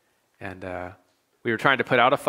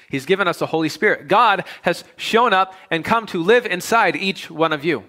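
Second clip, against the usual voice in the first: rising, louder preacher's build, slowing; preaching at full force; 7 semitones higher, 5 dB louder than usual. Real, not acted. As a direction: preach it with swelling conviction.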